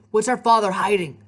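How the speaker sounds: loud, insistent question; demanding. A narrator's reading. Nico questioning his sister about their father, demanding. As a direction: press hard for the answer. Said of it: "he demanded".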